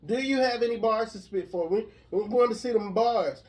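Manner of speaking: high, loud repeated demand; loud insistent. Real, not acted. Repeats question louder after "Do I?.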